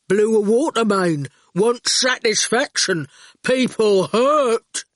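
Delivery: slow, heavy telegram speech; stubborn complaint in drawn-out words; blunt digger driver. A narrator's reading. Erskine's character voice.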